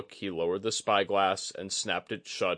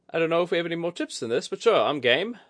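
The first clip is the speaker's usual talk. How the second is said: high, fast, loud eager reply; Kudaka, game; 10 semitones higher, 6 dB louder, faster than usual. A narrator's reading. Kudaka accepts Oguri's double or nothing, eager. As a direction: jump at the bet.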